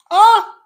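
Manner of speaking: sudden high, loud crying wail; a real sob breaking out. Real, not acted. Crying out in front of viewers, a sudden wail. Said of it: "I'm such a crybaby".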